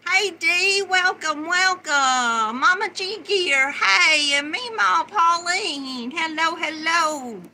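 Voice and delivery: loud, high, cheerful hellos; excited greetings. Real, not acted. Livestream host calling out loud welcomes to viewers. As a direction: call out the welcomes with gusto.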